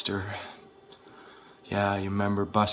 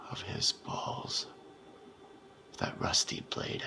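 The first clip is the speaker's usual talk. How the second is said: gloating whisper; 8 dB quieter than usual. Acted. Recalling the torture, whispering. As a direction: breathe it out, savouring it.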